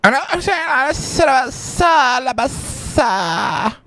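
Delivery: high-pitched gibberish parody of her talking; mimicking her mumble. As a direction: mimic her garbled speech in a high squeak. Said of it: "I couldn't hear her anyway".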